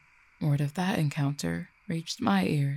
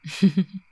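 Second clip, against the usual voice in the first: short, louder amused chuckle; teasing chuckle; 3 semitones higher, 6 dB louder than usual. Acted. Cupid teasing their love about a third round, chuckling. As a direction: chuckle to tease a partner.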